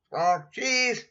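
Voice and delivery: high, loud squawk then cheese; parrot voice. Acted. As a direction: parrot screech of the word. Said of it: "Polly says".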